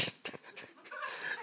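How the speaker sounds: soft real laugh without voice; breathy laugh. Real, not acted. Teacher amused while introducing the program's name, laughing softly.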